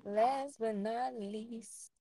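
slow, spaced-out and high; stoned. Real, not acted. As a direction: say it slowly, stoned and hazy. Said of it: "I think I'm high now too".

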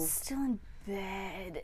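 very soft, slow, groggy words; mock half-asleep mumble; just-out-of-bed voice. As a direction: sink back into the pillow as you say it. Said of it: "talking to me in this voice, like, he just got out of bed".

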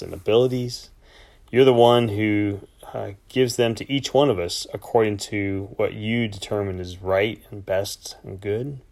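hushed humble prayer. Acted. Opening prayer before reading.

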